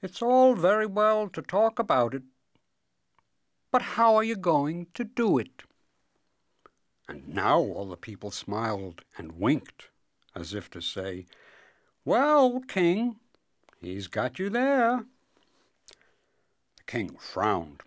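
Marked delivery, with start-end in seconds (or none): 0.0-5.6 higher, louder character voice, grumbling doubt; gloomy cobbler. A narrator's reading. Cobbler asks the king how he'll do it, gloomily. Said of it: "the wise cobbler said gloomily". 12.1-15.1 high, teasing crowd voice; knowing crowd. Acted. People as if to say to the king, teasing.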